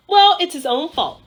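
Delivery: irritated, defensive reply; defensive snap. Acted. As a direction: shut down the criticism. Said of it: "said Jessie".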